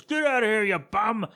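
hammed-up angry dismissal; mock yell. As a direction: pretend to yell someone away. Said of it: "He yells at the cat".

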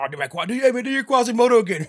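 high, loud teasing voice with a laugh; mock egging on. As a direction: hype him up to do the bit. Coworkers egging him on.

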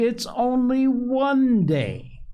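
put-on slow, high little girl voice; little girl slowly explaining to her mother. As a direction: draw it out like a stubborn small child. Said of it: "said Phronsie slowly".

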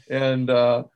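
ordinary talk, nothing marked

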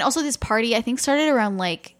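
ordinary talk, nothing marked

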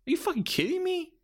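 can't believe what he's seeing; exasperated disbelief. Retold or imitated. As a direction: pitch leaps way up in disbelief. Ref's finish ruins the match.

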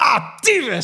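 high, loud yelled curse; delayed pain outburst. Acted. Swearing ten seconds after injury, yelled. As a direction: burst out cursing in mock agony. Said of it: "na tien seconden ben je van".

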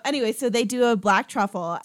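ordinary talk

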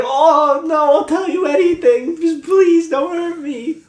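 high, pleading terrified voice; cowering plea. Acted. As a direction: beg for mercy, slowly. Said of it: "he's like".

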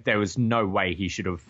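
usual speaking voice

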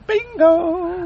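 knowing it would set her off, a high told-you-so "Bingo"; gleeful bingo. Retold or imitated. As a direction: call it out like you won. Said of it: "I said".

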